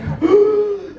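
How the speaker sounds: sharp high gasp for air, hammed up; a comic high gasp; mock gasp. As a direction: play the winded gasp after the tackle. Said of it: "And I'm like".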